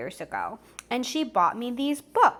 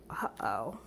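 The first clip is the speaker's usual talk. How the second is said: mock worry; 12 semitones lower, 11 dB quieter than usual. Real, not acted. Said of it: "reacting to the story".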